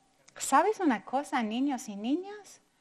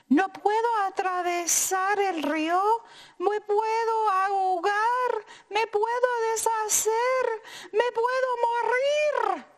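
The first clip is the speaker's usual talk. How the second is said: rising higher and higher in panic; panicked tortilla; 9 semitones higher, 4 dB louder than usual. Acted. Tortilla at the river, panicking ever higher. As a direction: climb in pitch with each fear. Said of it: "exclamó la tortilla".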